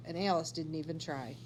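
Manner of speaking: usual speaking voice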